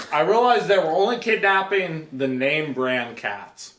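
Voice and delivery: ordinary talk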